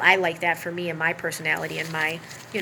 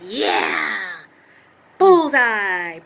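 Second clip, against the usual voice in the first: a mock gloating villain, the last word sharply louder; gloating sociopath; 7 dB louder, much slower than usual. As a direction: savour the hit like a predator. Imitating sociopath relishing pain.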